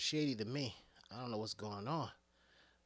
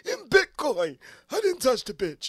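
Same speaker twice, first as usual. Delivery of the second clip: high, loud indignant yell; mock Bernie shout; 10 semitones higher, 14 dB louder than usual. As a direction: shout the denial like an outraged old man. Bernie voiced, shouting denial.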